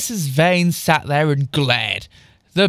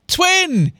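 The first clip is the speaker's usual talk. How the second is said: high-pitched playful call; a mild, high yell; 13 semitones higher, 3 dB louder than usual. A narrator's reading. Trent greeting twin in class, playful high yell. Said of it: "yelled Trent, laughing".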